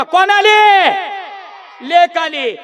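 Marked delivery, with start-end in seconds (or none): 0.0-0.9 louder, rousing call to the crowd; loud prompt. Shown as a demonstration. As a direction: shout the question to get a loud answer. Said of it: "मोठ्यानं बोला". (मोठ्याने विचारलेला प्रश्न)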